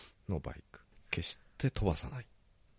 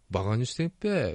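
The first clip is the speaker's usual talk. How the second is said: Ibaraki dialect imitation; 3 semitones higher, 5 dB louder than usual. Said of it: "って言われそうな".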